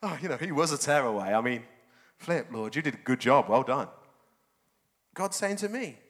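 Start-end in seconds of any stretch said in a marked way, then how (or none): 0.0-3.9 smug self, lower. Retold or imitated. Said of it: "I was so quick to see it in someone else".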